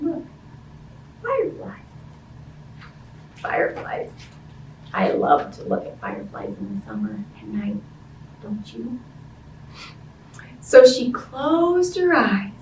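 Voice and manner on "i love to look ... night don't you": hushed whisper, quick and close. Real, not acted. Teacher whispers an aside to the children. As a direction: share a secret in a whisper.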